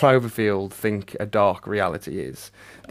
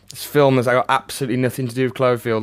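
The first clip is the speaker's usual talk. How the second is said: usual speaking voice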